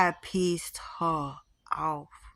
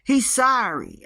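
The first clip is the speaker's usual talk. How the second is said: exasperated; 5 semitones higher than usual. Real, not acted. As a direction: splutter it out, half laughing in disbelief. Exasperated outburst about the ghost.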